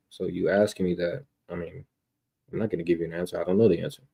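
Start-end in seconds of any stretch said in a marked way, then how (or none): none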